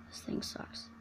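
whispering. Real, not acted. Whispered gripe.

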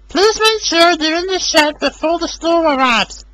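high voice of Emily warning. A narrator's reading. Emily warning Rosie about the storm, high voice. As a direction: caution Rosie before the storm. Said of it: "she warned".

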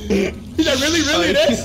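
loud, high-pitched disbelief; incredulous outburst. Real, not acted. Player reeling from a friend's blunder, incredulous outburst. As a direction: blurt it out loudly, high with amazement.

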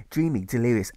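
plain reading voice

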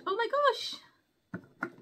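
a slow, high, drawn-out oh my gosh; awed gasp. Real, not acted. Peeking inside the chest, slow high awe. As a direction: stretch it out in wonder.

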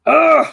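frustrated grunt. Real, not acted. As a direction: grunt out your irritation at the drawing. Frustrated with the drawn nose.

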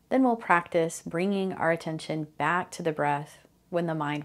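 calm, unhurried meditation guide with long pauses; guided meditation. Real, not acted. Host guiding listeners through mindfulness of breathing, calm guided meditation. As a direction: ease listeners into the breath, phrase by phrase.

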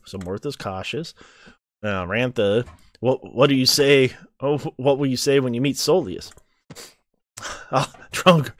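ordinary talk

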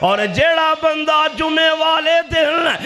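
ringing, sustained preacher's delivery; full-force preaching. Real, not acted. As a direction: proclaim each point in drawn-out phrases.

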